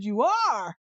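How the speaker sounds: loud, scornful comeback; loud scornful comeback to the threat; loud challenge. Retold or imitated. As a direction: throw it back at them loudly. Confronting the person.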